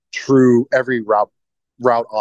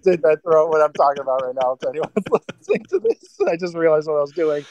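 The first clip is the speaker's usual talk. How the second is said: laughing; 7 semitones higher than usual. Real, not acted. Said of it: "looking like a freak".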